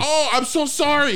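very high, over-polite apology; apologetic Canadian cop. Retold or imitated. Canadian cop apologizing to lost tourist, high and over-polite.

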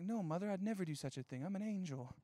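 soft, innocent denial. Retold or imitated. Son lying to his mom about the money, soft mock innocence. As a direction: play the little angel, softly.

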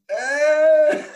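very high, loud, drawn-out mock wail; retold wail. Runner alone in the bush, imitated high wail. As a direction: squeal out an exaggerated cry of pain.

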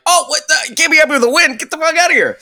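high, incredulous mock outrage; mock exasperation. Fan snaps back at the gloomy pundit in mock exasperation. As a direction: scoff at the killjoy in disbelief. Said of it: "And you're like".

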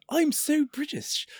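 high, slow mock British accent. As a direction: lampoon an over-the-top British accent. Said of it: "it's over the top".

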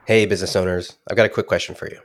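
ordinary talk

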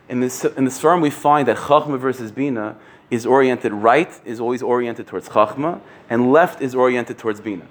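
usual speaking voice

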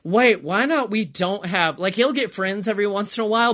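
ordinary talk, nothing marked

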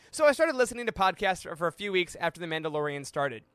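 ordinary talk

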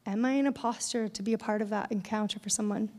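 ordinary delivery

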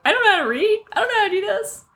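high-pitched, fretful child imitation; nervous kid. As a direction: fret like a first-grader out of their depth. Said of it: "got real nervous about".

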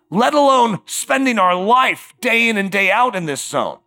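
ordinary talk

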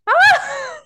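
sudden high, loud laugh; real delighted laugh bursting out; burst of laughter. Real, not acted. Host cracking up at the guest's road-rage story, laughing.

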